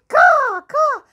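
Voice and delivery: loud, high-pitched cawing; noisy raven. Pretend. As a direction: squawk over the host like a noisy bird. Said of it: "he's really a noisy bird".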